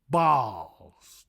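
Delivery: ordinary talk, nothing marked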